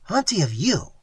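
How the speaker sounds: the Indian asks again, firmer; repeated question. A narrator's reading. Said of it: "he repeated".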